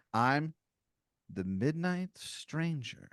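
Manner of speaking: mysterious stranger. Acted. Second intruder announcing himself to a player, slow and mysterious. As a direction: draw the title out for mystery.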